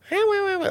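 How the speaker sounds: high whiny wah wah; mock whining wah. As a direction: whine like a crying kid. Imitating kids whining.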